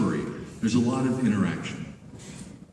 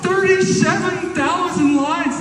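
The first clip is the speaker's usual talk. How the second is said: incredulous exclamation; 4 dB louder, slower than usual. Real, not acted. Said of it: "exclaiming the huge number".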